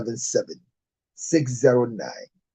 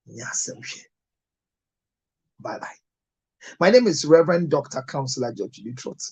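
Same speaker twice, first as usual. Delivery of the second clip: ordinary talk, nothing marked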